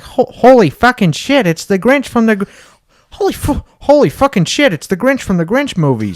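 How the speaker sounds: loud, high cartoon dad impression; Peter Griffin. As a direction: blurt it out in hammed-up amazement. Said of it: "Peter's like".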